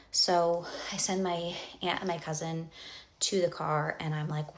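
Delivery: normal talking voice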